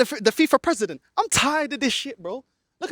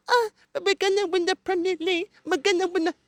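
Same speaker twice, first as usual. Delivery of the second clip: high, mocking whiny fan; higher put-on voice of a fan making excuses; 7 semitones higher than usual. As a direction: sneer like a deluded fan. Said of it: "you man are gonna justify".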